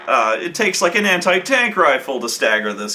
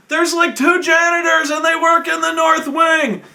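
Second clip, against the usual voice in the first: high, loud panicked answer; terrified squeal; 13 semitones higher, 3 dB louder than usual. Retold or imitated. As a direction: blurt it out in terror. Terrified prisoner answers, high pitch.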